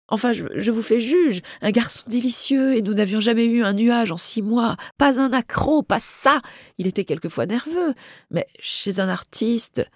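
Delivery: high, plaintive pleading; high-pitched, aggrieved society woman; indignant jilted friend. Acted. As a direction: plead your case, wounded and worked up. Friend pleads her case in dialogue.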